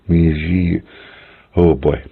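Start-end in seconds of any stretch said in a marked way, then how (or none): 0.1-0.8 "Mi Ji" with a hushing zh; careful "Mi Ji", sh-like middle; sh sound. Shown as a demonstration. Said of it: "this is a sh sound".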